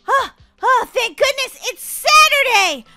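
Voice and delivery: high, drawn-out burst of relief; acted relief. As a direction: gasp it out, hugely relieved. Realises it is Saturday.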